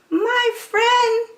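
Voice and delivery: very high, squeaky little voice; tiny high mayor of Whoville; tiny speck voice. Acted. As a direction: voice from a speck of dust. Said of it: "came the voice".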